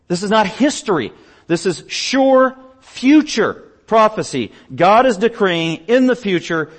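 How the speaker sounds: high, loud, drawn-out proclamation; emphatic prophetic declaration, weighty key words; forceful preaching. Real, not acted. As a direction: hammer each phrase from the pulpit.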